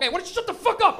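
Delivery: loud, high acted scolding; angry outburst. Friend silencing a reluctant passenger in the car, angry shout. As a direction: yell at him to silence him.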